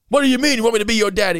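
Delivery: drill sergeant yell. Retold or imitated. Drill sergeant shouting at the acting-out kid. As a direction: fire it off like a drill instructor. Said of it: "the guy's like".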